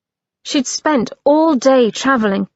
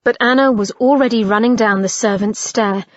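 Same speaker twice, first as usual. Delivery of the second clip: usual speaking voice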